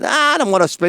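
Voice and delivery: high-pitched mock reluctant protest; retold reluctance. Acting out reluctant dinner exchange.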